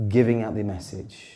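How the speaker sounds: dramatic pause before the message; delivered after a pause. Shown as a demonstration. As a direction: hold back, then land it. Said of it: "and then suddenly".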